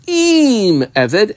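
"Im" drawn out high and loud, then Eved; stress on Im. Shown as a demonstration. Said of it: "emphasis on the word Im".

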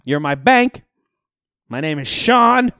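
loud exasperated announcement; shouting to the bank staff about his check; retold yell. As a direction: tell off the whole bank. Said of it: "I was like".

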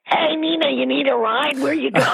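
light mock cop voice, high and breezy with a laugh at the end; retelling the cops offering a ride, high and amused; imitating the cops. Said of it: "And they go".

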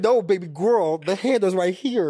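high, loud, sassy insistence; sassy correction. Retold or imitated. Telling her friend the photo is real, loud sassy insistence. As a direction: set her straight with high, loud sass.

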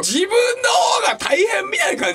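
速く高い声で叫ぶ突っ込み; 半泣きで怒鳴る; 泣き笑いで叫ぶ. Real, not acted. 泣き笑いの叫び. (相方に泣き笑いで怒鳴る)

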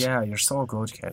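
deadpan sarcasm, quietly; quiet sarcastic dig at his friend; sarcastic praise. Real, not acted. Mocking friend's bragging.

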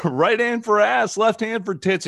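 high, loud chanting holler; imitated crowd heckle. Outfield fan shouting a challenge to a player, imitated heckle. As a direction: yell it up high like a rowdy fan. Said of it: "twenty people in the outfield, and he goes".

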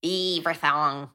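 "Everything" slow, broad vowels in a put-on accent; drawn-out "Everything", last vowel opened to "thong"; accent attempt. Shown as a demonstration. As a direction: exaggerate the accent on "Everything", slowly. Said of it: "that sounds more South African".

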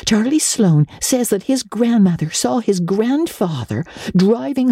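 put-on high, breathless girl telling tales; a little girl spilling ghost stories; eager young girl. A narrator's reading. Anne voiced, excited tales.

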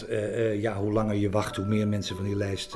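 ordinary talk, nothing marked

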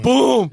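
loud, high gunshot boom; shouted boom. Retold or imitated. Friends retelling the shot, shouting boom. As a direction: blast it out like a shot.

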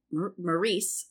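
"Maurice" said in place of "Maurizio", imitating Kim; "Maurice" with a stop on "Mar-", mock Kim; Kim's way of saying it. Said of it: "as Kim would say".

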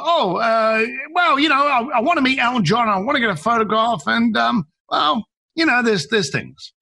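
high-pitched floundering, trailing off at the end; hesitant. Retold or imitated. Caller asked why it is important, hesitant and floundering. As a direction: stumble through fillers, imitating someone lost for reasons. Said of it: "it was that last hesitation".

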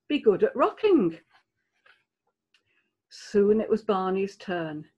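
ordinary delivery

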